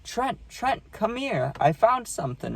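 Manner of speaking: high-pitched grandma voice calling him over. Retold or imitated. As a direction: call him like his grandma, pitched well up. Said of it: "she goes".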